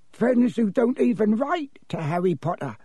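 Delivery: high, sly elf voice; high-pitched, insinuating elf; sly house-elf. Acted. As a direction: needle him slyly as a little creature. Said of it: "said Dobby slyly".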